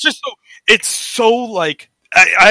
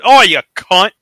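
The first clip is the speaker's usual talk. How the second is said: louder, punchy Australian jibe; mock Australian accent; 2 semitones higher, 5 dB louder than usual. Podcaster mimicking how Australians curse, mock Australian accent. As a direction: imitate a casual Australian insult. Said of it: "Australians throw around the word".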